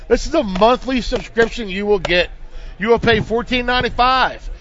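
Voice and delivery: usual speaking voice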